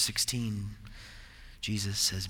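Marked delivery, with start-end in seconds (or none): none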